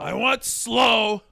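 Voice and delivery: high, loud, very slow whining shout; whiny demand. Acted. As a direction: wail it like a spoilt child. Mocking friend's complaint.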